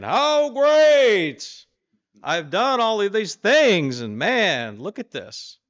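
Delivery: giddy self-praise, high and loud; boasting king. Acted. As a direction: crow over your own greatness like a vain king. King mid-boast, acted out.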